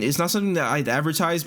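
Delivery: normal talking voice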